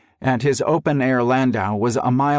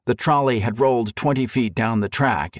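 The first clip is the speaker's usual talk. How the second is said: ordinary delivery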